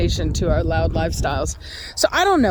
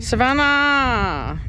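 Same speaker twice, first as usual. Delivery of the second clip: calling out; 3 semitones higher, much slower than usual. Real, not acted. Calling out to Savannah.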